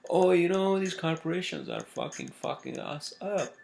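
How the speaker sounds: high whiny mock complaint; mocking liberal voice. A vlogger mimics liberals complaining about corporations, high and whiny. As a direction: whine it like a parody liberal. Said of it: "Then you complain that".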